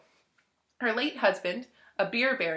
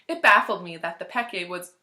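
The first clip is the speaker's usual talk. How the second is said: ordinary delivery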